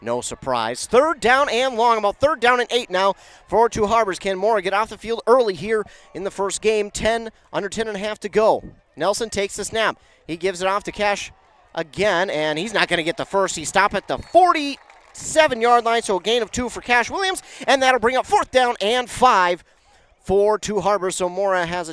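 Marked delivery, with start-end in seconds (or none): 0.9-5.8 raised, louder call of the down; live play-by-play. Acted. Building to a key down. 13.5-21.2 raised, louder call of the down; live play-by-play. Acted. Calling the stop live.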